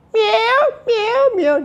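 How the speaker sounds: very high mewing meow; cat meow. Shown as a demonstration. Teacher demonstrating a cat sound, very high meow. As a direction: mew like a cat. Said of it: "this means cat's voice".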